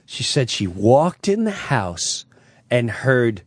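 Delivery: ordinary talk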